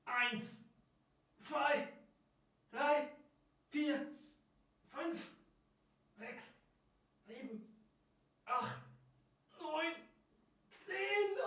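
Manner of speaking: straining counts during reps; doing push ups. Real, not acted. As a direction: count through the effort. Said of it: "Nochmal zehn". (angestrengt beim Liegestützen)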